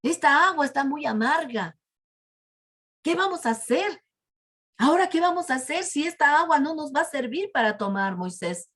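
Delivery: acted crowd protesting in dismay; disgusted complaint. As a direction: react to a horribly bitter mouthful. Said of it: "la sacaron de su boca y dijeron".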